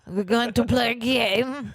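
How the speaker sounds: loud, drawn-out put-on voice; hammy game-show intro; mock announcer. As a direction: play up the game intro slowly, loudly. Mock game-time voice, slow and loud.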